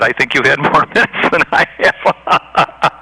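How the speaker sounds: slow words breaking into a laugh; wry laugh at the host. Real, not acted. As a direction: laugh it off while making the point. Laughs while complaining about minutes.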